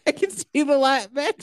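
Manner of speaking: high-pitched, hammed-up last words; mock dying voice. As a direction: play a melodramatic deathbed farewell. Play-acting dying after being stabbed.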